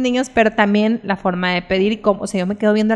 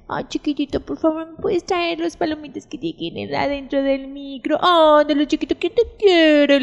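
put-on baby talk voice; 5 semitones higher than usual. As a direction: coo at your spouse like a baby. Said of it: "hacemos la voz de". (voz aniñada y melosa de esposo pidiendo algo)

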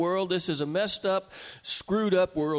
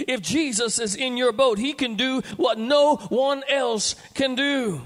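raised up and slowed down; building emphasis; 6 semitones higher, 5 dB louder than usual. Shown as a demonstration. Preacher repeats the line to the congregation, louder and slower. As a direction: lift the repeat into a proclamation. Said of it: "repeated louder".

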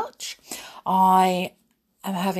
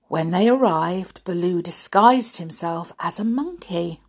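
usual speaking voice

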